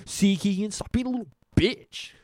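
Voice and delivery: high, exasperated telling-off; imitated impatient berating; mock scolding. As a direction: scold like a fed-up brother. Acting out Tristan scolding Keegan.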